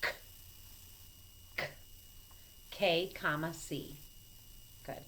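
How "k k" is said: K said twice as a short crisp k sound; phonics sound. Shown as a demonstration. Teacher modelling the k sound for students, crisp. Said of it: "This is the longest one".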